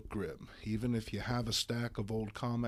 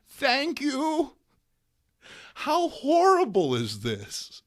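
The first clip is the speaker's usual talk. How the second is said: much higher, louder, slow scandalised granny; indignant old lady; 9 dB louder, slower than usual. Acted. Aunt May fuming at the paid toilet, high slow indignant.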